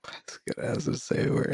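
soft unvoiced whisper. Real, not acted. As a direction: breathe it in a whisper. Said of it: "whispered reply".

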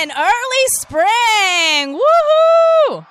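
slow, high, loud celebration; excited cheering. Real, not acted. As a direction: stretch it out and cheer. Celebrating the forecast.

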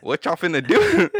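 slow, high and loud, laughing through it; imitating himself watching the doctors, laughing; laughing mock alarm. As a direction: drag out the panicked question. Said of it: "You know what I'm saying".

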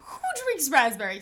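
normal talking voice

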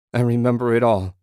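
anguished insistence. A narrator's reading. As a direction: insist loudly, in distress. Anguished man recalls everything.

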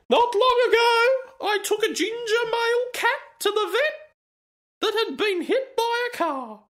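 sanctimonious falsetto parody; mocking high reading voice. Reading the post in put-on voice.

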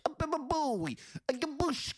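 very high squeaky cartoon battle cry; Impmon attack cry. Host imitating Impmon's attack call, very high squeaky. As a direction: squeal the attack like a tiny imp. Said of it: "his attack is literally like".

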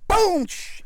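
loud high boom, hushing shatter; vocal crash with glass spraying; imitated crash.

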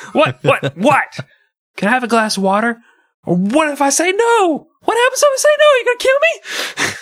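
hammed-up terrified parent; panicked shriek. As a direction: shriek at the kid with the knife. Waking parent panicked at knife-wielding child.

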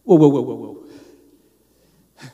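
loud awed whoas fading to a breathless whisper; overwhelmed whoa trailing into a whisper; stunned whoa. Retold or imitated. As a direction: start loud in shock, then let it die to a whisper. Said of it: "I was like".

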